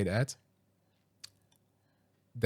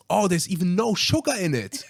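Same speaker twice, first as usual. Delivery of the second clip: excited; 8 semitones higher, 4 dB louder than usual. Retold or imitated. Excited reading the packaging.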